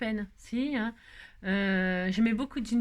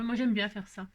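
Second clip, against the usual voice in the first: normal talking voice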